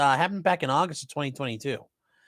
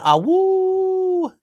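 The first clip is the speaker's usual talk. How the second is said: dog noise; 5 dB louder than usual. Retold or imitated. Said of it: "the dog went".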